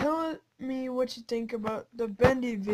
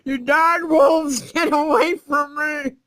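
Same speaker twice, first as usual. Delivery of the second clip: higher, louder mock despair of a player losing his people; mock victim wail; 5 semitones higher, 7 dB louder, slower than usual. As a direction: ham up the panic of someone robbed. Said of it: "He's like".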